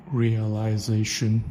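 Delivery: "realization" drawn out slowly, syllable by syllable; American-style full pronunciation. Shown as a demonstration. Speaker showing how Americans say it, "realization" slow and fully sounded. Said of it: "like the way Americans do".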